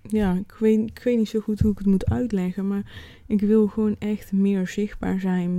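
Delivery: ordinary talk, nothing marked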